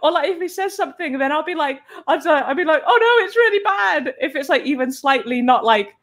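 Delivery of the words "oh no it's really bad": high-pitched playful dismay; mock despair. Artist overreacting to husband's mild comment, mock despair. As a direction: overreact as if it's a disaster.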